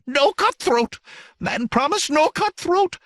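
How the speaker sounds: very high, squealing creature panic; terrified scream. A narrator's reading. Satyr cringes before the approaching dagger, screaming in terror. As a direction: shriek it in fear for your life. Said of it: "screamed in mortal terror".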